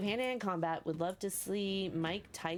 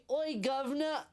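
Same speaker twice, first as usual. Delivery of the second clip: hammed-up Cockney, pitched higher; mock Cockney accent; 5 semitones higher, slower than usual. Gamer mocking the merchant's voice, exaggerated Cockney accent. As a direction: put on a silly Cockney caricature. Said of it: "have a Cockney accent".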